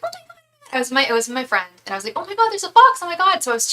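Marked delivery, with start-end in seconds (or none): 0.0-0.8 very high, soft little squeal; thrilled squeak kept small; hushed excited gasp. Retold or imitated. Retelling her first wild fox to viewers, hushed excited squeal. 2.1-3.4 high, louder excited outburst; excited fox sighting. Retold or imitated. Telling her friend there's a fox, high excited cry.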